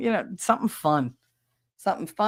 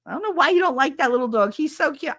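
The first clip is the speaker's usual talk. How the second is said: higher and fast, doting; girlfriend voice; 8 semitones higher, 3 dB louder, faster than usual. Retold or imitated. Girlfriend defending Scrappy, high and fast. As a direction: gush about the cute little dog.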